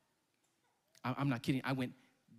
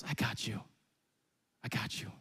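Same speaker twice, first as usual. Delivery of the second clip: reassuring; 11 semitones lower, 6 dB quieter than usual. Retold or imitated. Buddy after saving him.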